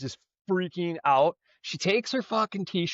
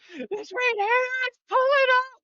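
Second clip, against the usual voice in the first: very high panicked squeal; high mock panicked squeal. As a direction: squeal it like she's freaking out.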